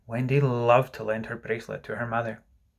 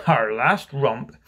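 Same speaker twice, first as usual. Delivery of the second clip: slow, drawn-out lament; groaning; 4 dB louder, much slower than usual. Acted. Mister Darling recalls the last romp, mildly groaning. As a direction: groan out each word slowly. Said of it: "Mister Darling groaned".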